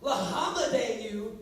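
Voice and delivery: Lahamadeu said slowly with a laugh; mock mispronunciation. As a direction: drag out Lahamadeu, amused. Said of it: "I have heard".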